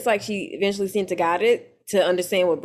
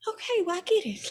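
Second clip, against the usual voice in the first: meek reply; 8 semitones higher, 7 dB quieter than usual. Retold or imitated. Jackie's retold weak reply.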